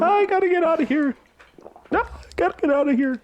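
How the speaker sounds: high squealed echo of cohost; mimicking squeal. Copying the laughing cohost's escape, high mimicking squeal. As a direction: parrot it back in a very high voice.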